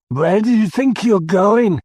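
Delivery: put-on a drunk commander barking from his table; drunken slur. A narrator's reading. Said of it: "His voice was slurred".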